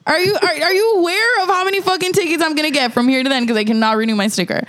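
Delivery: fast, high, near-tearful rant; frantic rant. Retold or imitated. Ranting at the clerk about tickets, fast and high. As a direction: spiral into mock panic over tickets. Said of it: "I was like".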